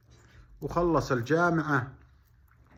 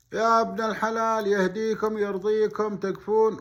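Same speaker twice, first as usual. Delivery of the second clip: loud pleading; 8 semitones higher, 6 dB louder than usual. Retold or imitated. Boy begs cooks for a sheep.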